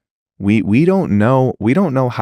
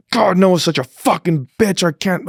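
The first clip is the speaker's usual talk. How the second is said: mock venting rant; 9 semitones higher than usual. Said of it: "I say".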